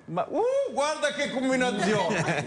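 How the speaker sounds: high, slow, amused fake astonishment; mock surprise. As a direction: act amazed at the coincidence, laughing.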